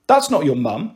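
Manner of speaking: ordinary delivery, nothing marked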